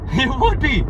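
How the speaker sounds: high-pitched amused insistence after a laugh; tickled by your own boast. Real, not acted.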